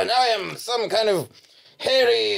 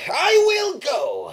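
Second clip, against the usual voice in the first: much higher, slow and loud, a grand proclamation; heroic declaration; 10 semitones higher, 3 dB louder, slower than usual. Acted. A hero to the devil, setting out on his quest, grandly proclaimed.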